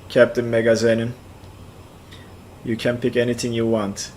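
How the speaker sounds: usual speaking voice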